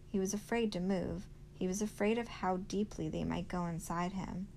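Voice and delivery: plain reading voice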